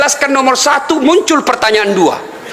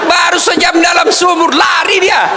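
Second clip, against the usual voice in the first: laughing shout; 8 semitones higher than usual. Real, not acted. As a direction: belt out the punchline, laughing. Punchline shouted to audience.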